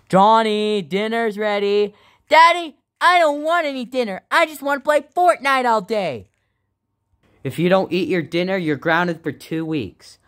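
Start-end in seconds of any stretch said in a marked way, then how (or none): 0.1-1.9 calling out. Acted. Parent calls child to dinner. 2.3-6.3 put-on high, whining little boy; whiny kid voice. Johnny whines at dad.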